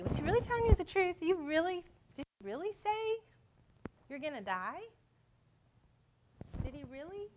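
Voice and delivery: high-pitched, insinuating questions; casting doubt. Acted. Preacher voices Satan questioning God's integrity to Eve, casting doubt. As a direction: plant doubt with each lifted question. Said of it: "casts doubt on God's integrity".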